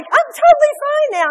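very high, bright-voiced chirp; breezy after the shopping trip; cheery wife. Wife tells her husband she is totally fine, very high and cheerful. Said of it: "I was like".

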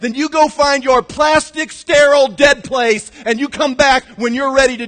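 loud, high-pitched preacher's shout; a sermon line shouted from the pulpit; preaching shout. Real, not acted. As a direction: throw it at them full force. Preacher mocks a cold church.

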